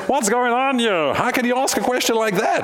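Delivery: high-pitched mock New York protest; New York accent. Disciples challenging Jesus's question, mock New York accent. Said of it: "with my New York accent".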